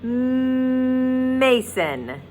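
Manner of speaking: "Mason" with a long held mmm at the start; drawn-out M. Shown as a demonstration. Teacher stretching the M in "Mason" for the kids. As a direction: hum the M in "Mason" long before opening. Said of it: "what my mouth is doing when I say Melanie and Mason".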